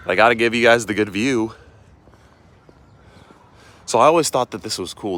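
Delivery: winded from the uphill climb; breathless after walking uphill; out of breath. Real, not acted. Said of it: "I'm gonna be out of breath".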